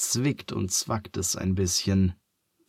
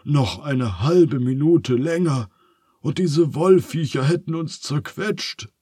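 higher, animated relief; lively, pitched-up relief; relieved; 6 semitones higher, 4 dB louder than usual. A narrator's reading. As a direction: exclaim it after a narrow escape. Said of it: "Phineas seufzt erleichtert".

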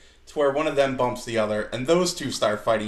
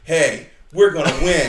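mock promo; 3 semitones higher, 9 dB louder than usual. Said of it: "they're like".

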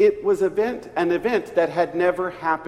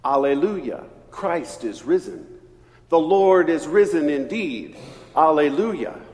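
a louder call-and-response proclamation; Easter acclamation; 3 semitones lower, 4 dB louder than usual. Shown as a demonstration. Preacher leading the Easter greeting, proclaimed louder.

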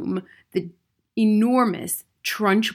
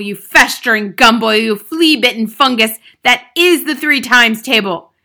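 loud, higher-pitched hectoring rant; bullying headmistress at full volume; shouted tirade; 6 semitones higher, 11 dB louder than usual. A narrator's reading. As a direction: rage at a hopeless pupil. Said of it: "shouted the Trunchbull".

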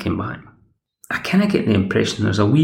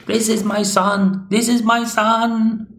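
higher and slower, proudly showing off; overjoyed boasting; 13 semitones higher, slower than usual. Retold or imitated. Old woman boasting to the villagers, overjoyed and high. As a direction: crow it to the whole village. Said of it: "she's overjoyed and she's boasting".

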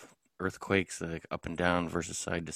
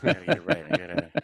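higher, louder, through laughter; laughing agreement; 9 semitones higher, 5 dB louder than usual. Real, not acted. Teased as staff scientist, laughing agreement.

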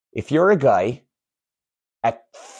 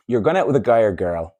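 usual speaking voice

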